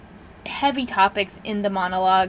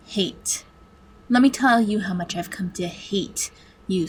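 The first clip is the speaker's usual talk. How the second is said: menacing computer villain, emphatic on key words; hateful master computer. Acted. Supercomputer tells its human captives of its hate, seething. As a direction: seethe with measured hatred, stretching the key words.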